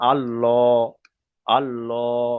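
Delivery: "আল্লাহ" as a slow, rounded "Al-lo"; thick Allah. Shown as a demonstration. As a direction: draw out a heavy "lo" in "আল্লাহ". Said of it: "ল করে পড়তে হবে". ("আল্লাহ" পুর করে, টেনে "আল্ল")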